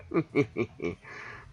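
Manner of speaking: quiet put-on chuckle; villain chuckle. Villain chuckle after threat.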